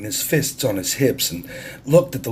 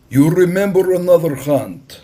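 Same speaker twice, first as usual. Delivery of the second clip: higher, louder, commanding stranger; bold Russian captain; 8 semitones higher, 6 dB louder than usual. A narrator's reading. Russian captain to the island chief, higher and louder. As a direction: announce it like a man who owns the harbor. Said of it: "Captain Orlov said".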